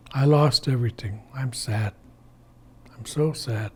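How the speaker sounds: low, soft sulking to oneself; quiet, downcast self-pity; sad. Acted. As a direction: let it sink softly into sadness. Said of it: "He sits down in his sadness".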